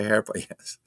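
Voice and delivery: amused chuckle through words; laughing. Real, not acted. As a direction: laugh as you say it. Laughing at chat comment.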